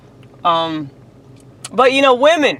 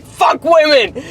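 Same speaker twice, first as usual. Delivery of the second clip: mock outburst; 6 semitones higher, slower than usual. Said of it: "I was like".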